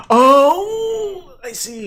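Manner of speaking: high, loud dawning oh; surprised realization. Real, not acted. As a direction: suddenly get it, voice jumping up. Realizing the chess move.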